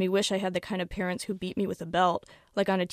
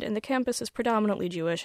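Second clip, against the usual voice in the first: ordinary delivery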